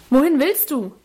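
put-on boy calling Wohin? after the girl; calling after her. A narrator's reading. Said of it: "rief mir Lebrecht nach".

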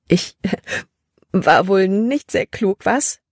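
slow words broken by a giggle; giggling. Acted. Libby giggling at herself after the scare. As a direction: laugh through the admission. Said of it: "Libby kicherte".

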